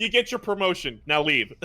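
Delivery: laughing, dismissive boss voice; gravelly old tycoon. Pretend. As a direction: wave them off like a tycoon.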